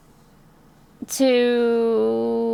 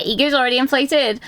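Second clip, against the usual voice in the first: ordinary delivery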